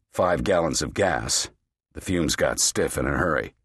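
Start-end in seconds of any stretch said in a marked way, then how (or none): none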